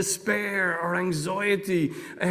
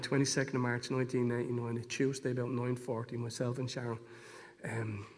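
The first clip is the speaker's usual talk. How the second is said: ordinary talk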